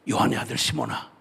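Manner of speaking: hushed, breathless calling of a name; whispered call. Acted. Jesus softly calling Simon at the shore, whispered.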